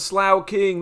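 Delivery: "Slough King" said as SLAU-king; joking mispronunciation. Pretend. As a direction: rhyme "Slough" with cow in "Slough King". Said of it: "That's how you pronounce that Pokemon".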